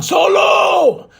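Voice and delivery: loud, high call across the arena; shout. Retold or imitated. Jacob and Tama calling for Solo, loud high shout. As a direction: bellow his name like you're searching.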